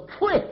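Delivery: sharp spitting pah. Acted. Spitting sound in narration.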